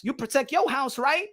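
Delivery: ordinary delivery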